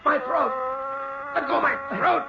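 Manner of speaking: high choked plea; terrified rasping cry; strangled panic. Acted. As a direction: beg it to let go as it squeezes. Ned being strangled.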